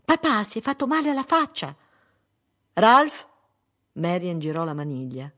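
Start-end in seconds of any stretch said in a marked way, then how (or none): 0.0-1.8 put-on high, loud little girl's announcement; child's shout. A narrator's reading. Daughter telling mother father is hurt, high shouting. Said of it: "gridò la figlia". 2.7-3.3 high, loud, rising call. Acted. Wife calling to locked-in husband, loud questioning call.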